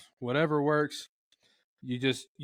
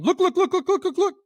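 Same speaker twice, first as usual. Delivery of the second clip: much higher, louder look look look in a rapid run; high alarm putt; 7 dB louder than usual. Retold or imitated. Hunter imitating a turkey's alarm putt, high and loud. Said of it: "a lot of clucks in a high pitch in a row".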